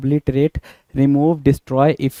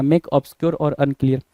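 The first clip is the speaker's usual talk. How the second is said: slow dictation; much slower than usual. Shown as a demonstration. Teacher repeats the definition.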